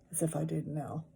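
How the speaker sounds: plain reading voice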